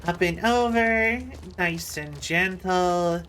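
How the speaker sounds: slow, drawn-out coaxing; slow self-guidance through a delicate move; careful coaxing. Real, not acted. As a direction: ease it along gently, stretching the words.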